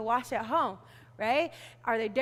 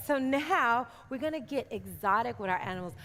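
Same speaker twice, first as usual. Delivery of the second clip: usual speaking voice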